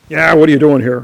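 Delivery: a marshal confronting the visitor, loud; loud challenge. Retold or imitated. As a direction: challenge the intruder.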